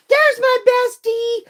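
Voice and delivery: high, sustained singsong squeal; excited greeting. Real, not acted. Spotting a best friend joining in, thrilled. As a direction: sing out a delighted welcome.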